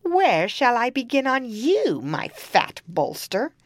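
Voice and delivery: shrill hungry hag; witch voice. Acted. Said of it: "she came to the second and asked".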